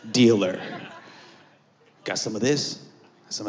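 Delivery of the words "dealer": ordinary talk, nothing marked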